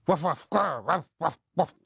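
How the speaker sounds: human imitation of woofs and a growl; mock dog barking. Presenter talking to his dog Wellington, mock barking. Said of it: "That's a message to my dog".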